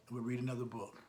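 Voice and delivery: ordinary delivery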